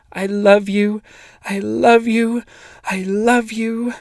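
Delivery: high, slow, loud sobbing cry. A narrator's reading. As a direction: sob it out. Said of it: "her sobs almost as loud as his".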